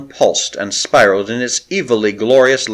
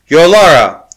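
loud, high call ringing out sweetly; 6 semitones higher, 9 dB louder than usual. A narrator's reading. Handmaiden calls from the window to the priestess, ringing and loud. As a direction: call her name across the hall. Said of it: "The golden voice rang out sweetly".

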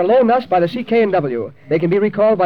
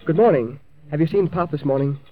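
ordinary delivery

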